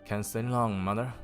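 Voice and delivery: plain reading voice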